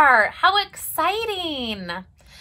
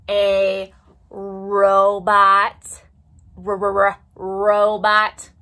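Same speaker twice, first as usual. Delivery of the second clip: robot voice; 7 semitones lower, 4 dB louder, much slower than usual. Shown as a demonstration. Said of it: "use your robotic voices".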